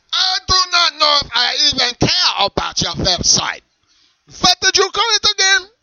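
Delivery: offended German shout; German accent shouting. Acted. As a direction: bark it in a thick German accent. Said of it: "your German accent".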